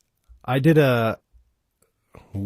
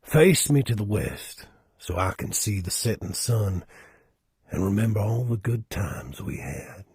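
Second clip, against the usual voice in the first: Southern accent. Shown as a demonstration. Performer reading a requested quote, Southern accent. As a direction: read it as an old Southern gentleman. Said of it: "read this in a Southern accent".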